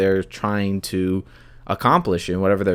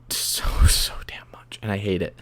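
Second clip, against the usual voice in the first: rueful whisper; 3 semitones lower, 6 dB quieter than usual. Real, not acted. Confessing social media habit.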